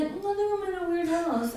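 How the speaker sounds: high, mock caller voice; heroine phoning police. Voicing heroine's imagined police call.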